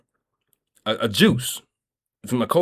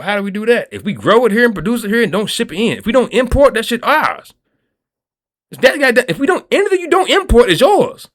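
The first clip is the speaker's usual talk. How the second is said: heated rant; 9 semitones higher, 5 dB louder than usual. Real, not acted. Heated rant about imports and prices.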